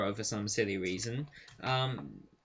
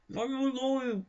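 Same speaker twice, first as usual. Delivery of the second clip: much higher, squashed into the hand; high muffled squeal; 12 semitones higher than usual. Shown as a demonstration. Showing a kissed line comes out garbled, high mock squeal. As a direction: squeak it out while kissing a hand. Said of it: "If I try kissing my hand while saying".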